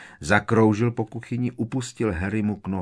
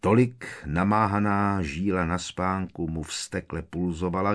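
usual speaking voice

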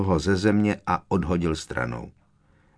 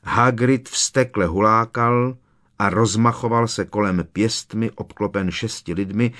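usual speaking voice

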